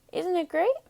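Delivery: put-on high, quick, cheerful young sheep voice; Wilbur the lamb. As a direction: chirp it like a proud little lamb.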